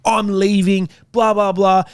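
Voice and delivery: half-acted furious demand to the mechanic; losing it. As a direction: demand the car back in a mock rage. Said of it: "and I lost it".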